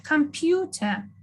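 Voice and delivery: slow Computer with a drawn-out PEW; stress on pew. Shown as a demonstration. Teacher drilling the stressed syllable, Computer said slowly with stress on PEW. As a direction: stretch out Computer, leaning on PEW.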